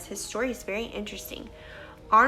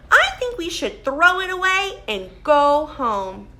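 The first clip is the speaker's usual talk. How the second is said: louder Toad voice, ready to quit; giving-up Toad; 5 semitones higher, 6 dB louder than usual. Acted. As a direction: sigh it out as if giving up. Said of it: "he said".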